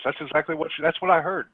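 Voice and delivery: normal talking voice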